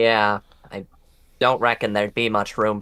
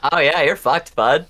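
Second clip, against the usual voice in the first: put-on Canadian accent; 7 semitones higher, 3 dB louder than usual. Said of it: "starting to get a Canadian accent".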